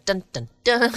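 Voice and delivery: mock suspense sting, sung out in jest; dramatic dun dun dun. Host teasing mystery night with a mock dramatic sting. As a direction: hum a dramatic music sting, tongue in cheek. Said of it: "the noise, dun, dun, dun".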